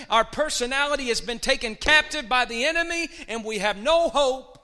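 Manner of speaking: loud, rising sermon cry; loud fervent preaching; preaching at full force. Acted. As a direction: shout it to the congregation.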